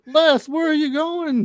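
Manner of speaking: high, slow calling voice; dad calling out. Retold or imitated. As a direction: call after the son like a goofy dad. Imitating dad calling son.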